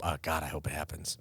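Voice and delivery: ordinary talk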